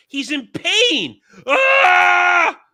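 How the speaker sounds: loud high yell of pain; pained scream. Retold or imitated. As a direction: cry out in sudden pain. Imitating Superman's pain scream.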